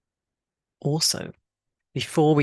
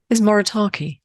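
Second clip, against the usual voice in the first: normal talking voice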